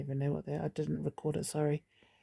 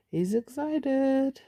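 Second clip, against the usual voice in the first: mock excited; 9 semitones higher, 5 dB louder, much slower than usual. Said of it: "as Wolvie said".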